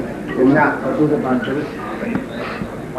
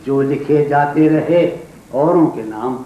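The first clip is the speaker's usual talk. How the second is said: poetry recitation. Acted. Poet reciting a couplet to an audience, declaimed verse. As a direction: declaim the verse phrase by phrase, with pauses.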